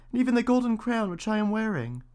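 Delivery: put-on high, bright-eyed young princess. A narrator's reading. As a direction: lift it up into a girlish princess. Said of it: "said she".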